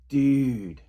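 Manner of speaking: loud, falling "Dude." of disbelief; exasperated dude. Shown as a demonstration. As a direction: scold a friend with one flat-landing "Dude.". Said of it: "You could be like".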